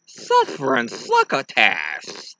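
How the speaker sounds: slow lisping cat; Sylvester voice. Retold or imitated. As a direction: spit out the lisp slowly. Sylvester catchphrase.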